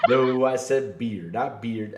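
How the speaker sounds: ordinary delivery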